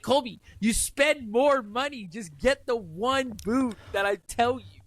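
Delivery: slow, high exasperated lecture; exasperated advice. Retold or imitated. Scolding a friend who never listens, slow and exasperated. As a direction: spell it out slowly to a stubborn friend. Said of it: "I'm like".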